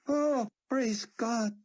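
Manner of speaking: slow, high, crying thanks; tearful praise. A narrator's reading. Yaakov seeing his mark, crying slow praise. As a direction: breathe it out through tears.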